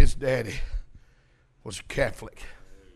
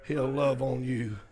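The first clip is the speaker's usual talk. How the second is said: soft, low, gentle preaching; hushed, comforting close after the shouting; tender assurance; 4 semitones lower, 6 dB quieter than usual. Real, not acted. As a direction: settle down and reassure them of love.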